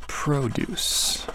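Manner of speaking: produce said softly, proDUCE, verb stress; quiet proDUCE while writing; murmured verb form. Shown as a demonstration. Teacher sounding out the verb, soft. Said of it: "Oh, and the verb produce".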